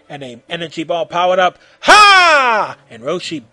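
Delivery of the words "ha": huge high, sustained shout; yelled battle cry. A narrator's reading. Roshi releasing the blast, huge drawn-out yell. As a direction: unleash the final syllable at full volume. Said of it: "put his fists together and yelled".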